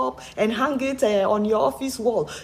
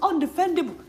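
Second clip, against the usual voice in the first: ordinary talk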